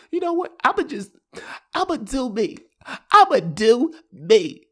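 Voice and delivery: put-on high, breezy girlfriend voice.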